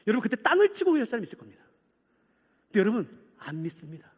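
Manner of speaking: ordinary delivery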